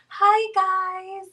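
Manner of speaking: high sweet fake-nice voice; sugary influencer greeting. Shown as a demonstration. Vlogger mimicking sweet influencers, high sugary voice. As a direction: coo it like a bubbly influencer. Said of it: "when I talk like this".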